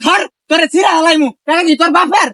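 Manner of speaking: high, angry shout; furious threat. Acted. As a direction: yell as you lunge at him. Collector attacks the debtor.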